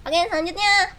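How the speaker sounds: usual speaking voice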